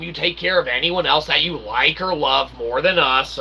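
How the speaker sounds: usual speaking voice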